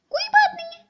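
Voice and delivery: high, loud mimicry of his words; mocking mimic. Wife mimics husband defending the daughter, high mocking voice. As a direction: throw his words back at him.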